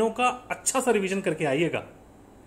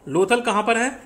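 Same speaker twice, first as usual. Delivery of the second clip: louder quiz question; 6 semitones higher, 6 dB louder than usual. Real, not acted. Teacher quizzing the class.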